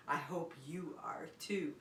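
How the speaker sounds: soft breathy whisper. Real, not acted. Teacher whispers kindly to preschoolers.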